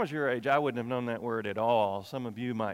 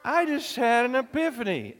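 excited; 10 semitones higher, 5 dB louder than usual. Shown as a demonstration. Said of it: "Do it again".

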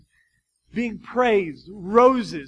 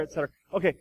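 ordinary talk

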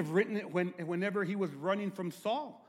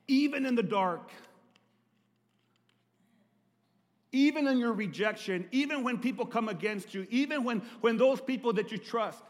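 preaching at full force; 7 semitones higher, 7 dB louder than usual. Acted. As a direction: preach it out loud, the cross as the climax. Pastor preaching to the congregation.

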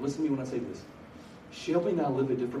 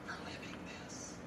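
whispered, letting the point sink in; barely audible whisper; hushed disbelief; 18 dB quieter than usual. Real, not acted. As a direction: drop to a whisper. Grave point about her being mocked.

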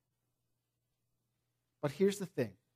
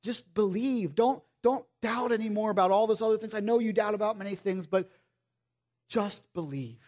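tender Jesus; 4 semitones higher than usual. Retold or imitated. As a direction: gently coax a doubting friend.

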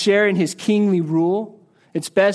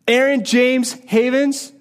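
a hint of a stern mother, slow and higher; scolding mother; 5 semitones higher than usual. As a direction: call out the full name like mom. Mother scolding by full name.

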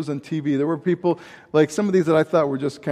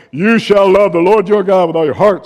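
proclaimed commandment; 9 dB louder than usual. Acted. Preacher proclaiming the great commandment.

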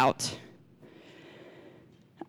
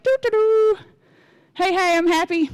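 chirpy, higher happy-go-lucky impression; Marty; 7 semitones higher than usual. Wife imitating her husband every morning, cheerful and singsong. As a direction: imitate someone waking up delighted to be alive. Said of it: "He's happy to be alive".

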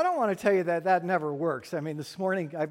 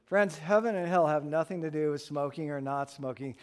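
usual speaking voice

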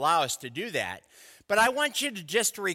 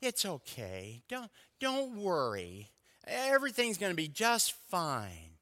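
gentle reassurance; 8 dB quieter than usual. Shown as a demonstration. God pictured as gently comforting his people, soft and soothing. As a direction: hush it kindly, like comforting a child. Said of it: "sometimes God is like".

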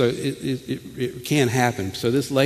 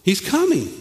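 much higher look, he's coming; excited announcing; slower than usual. Shown as a demonstration. Preacher rendering behold for congregation, excited and higher. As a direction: herald it with urgency. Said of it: "the language here is".

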